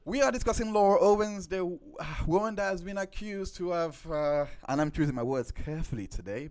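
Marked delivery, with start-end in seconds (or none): 0.0-2.5 show host intro. Acted. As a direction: welcome them like a game show host.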